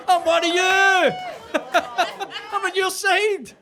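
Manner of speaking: high, loud words broken by laughter; laughing. Real, not acted. Comedian laughing through the punchline to the crowd. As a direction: crack up at your own joke.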